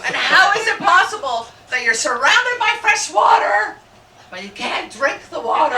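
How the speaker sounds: high, loud incredulous question; outraged shout. Real, not acted. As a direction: demand an answer from the crowd.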